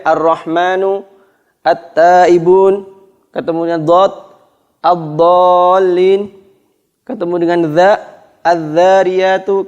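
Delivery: each word drawn out, lam merged into next letter; slow tajwid demo; slow recitation examples. Shown as a demonstration. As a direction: recite the examples slowly, doubling the first consonant. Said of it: "Begitu ke yang lain".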